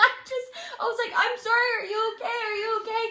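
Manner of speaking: high teary laughter; laughing. Real, not acted. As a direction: laugh while apologising. Laughing apology quote.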